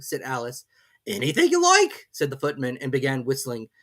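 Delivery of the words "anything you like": lofty servant talking down; footman's voice. A narrator's reading. Footman figure voice.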